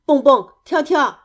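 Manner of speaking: anxious, projected calling of names; worried call. A narrator's reading. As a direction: call out anxiously from another room. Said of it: "担心地呼喊着".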